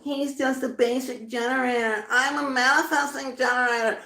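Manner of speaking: high-pitched jeering mimicry; mock the labelers in a high voice; mocking imitation. Reader mimicking people judging by labels, high mockery.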